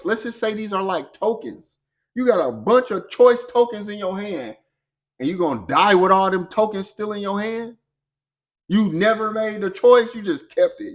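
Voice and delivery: rising sermon fervour about choices left unused; fired-up preaching. Real, not acted. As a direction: push it like a preacher at full force.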